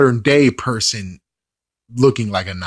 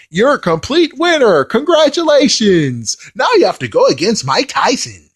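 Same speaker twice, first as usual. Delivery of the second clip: mock announcer; 7 semitones higher than usual. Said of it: "where it's like".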